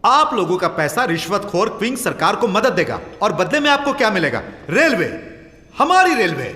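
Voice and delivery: loud, heated public protest; indignant, pitch climbing on the last phrases. Acted. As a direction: raise your voice to challenge the room. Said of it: "अपनी आवाज नीचे रखिए".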